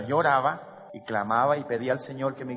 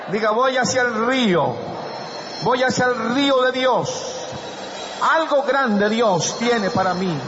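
high, loud rallying shout; full-force preaching; 8 semitones higher, 8 dB louder than usual. Real, not acted. Preacher leading the congregation's declaration, rousing shout. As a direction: call out lines for a crowd to repeat.

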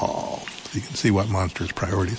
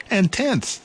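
high, bright little mouse voice; eager little mouse; 12 semitones higher than usual. Acted.

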